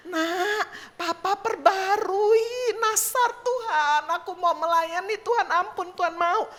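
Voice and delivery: high, acted crying plea; drawn-out mock wail, pitched high; tearful pleading father. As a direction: imitate a weeping dad begging for mercy. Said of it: "Dia nangis, dia bisikin anaknya".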